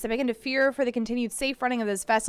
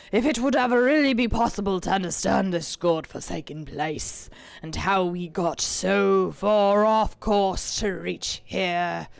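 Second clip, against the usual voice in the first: put-on pirate accent; 3 semitones lower, 5 dB louder, slower than usual. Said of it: "I think I need a pirate voice".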